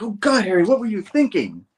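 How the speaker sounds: high, loud acted-out exasperation; exasperated dismay. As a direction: play an appalled friend, voice shooting up. Peter dismayed at Harry's confession.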